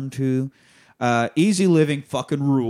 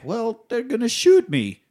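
whiny wife; 10 semitones higher than usual. Retold or imitated. Wife answering Sonny.

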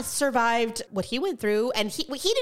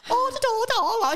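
higher, babbling put-on British-ish accent; mock weird accent; 11 semitones higher than usual. Mocking Dorit's weird accent, hammed-up fake British accent. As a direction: mimic a fake accent with nonsense syllables. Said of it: "She just has this weird accent".

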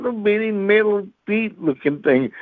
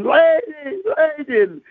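higher, urgent calls to the lady; mock screaming; 9 semitones higher, slower than usual. Preacher in the ladies' bathroom screaming higher for help. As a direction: call out in a panicked yelp. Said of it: "I'm in the bathroom screaming".

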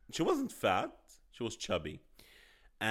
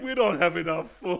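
louder, higher alarmed mock voice; mock panicked locals; 5 semitones higher, 7 dB louder than usual. Joking about Azerbaijan running out of food, loud mock alarm. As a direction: ham up a country crying famine. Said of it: "They're like".

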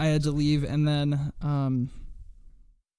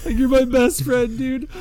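higher-pitched gushing friend, half-hearted; concussed buddy declaring love to Casey, imitated higher; imitating a dazed, emotional friend; 7 semitones higher than usual. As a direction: gush to your best friend in a higher voice. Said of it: "He's like".

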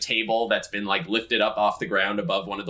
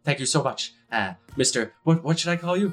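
lightly raised, relieved thanks; grateful lumber worker; 6 semitones higher than usual. Acted. Rescued worker thanking the Watcher, grateful and breathless. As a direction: gush thanks at your rescuer.

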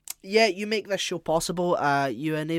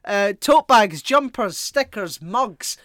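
high, loud greedy hustler; hyped sales pitch; 7 semitones higher, 8 dB louder than usual. Pretend. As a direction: push the merch like a mugger. Merch plug, greedy rant.

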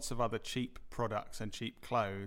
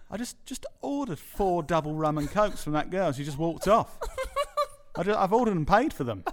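raised, louder customer protesting with laughter breaking in; indignant complaint; 6 semitones higher, 8 dB louder than usual. Retold or imitated. Customer complaining to the manager about unserved drinks, indignant. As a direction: act out an outraged customer, half laughing.

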